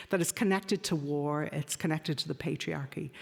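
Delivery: usual speaking voice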